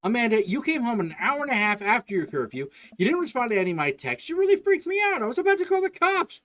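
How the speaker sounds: a scared parent venting; upset yelling. Acted. Dad confronting his daughter after curfew, frantic and loud.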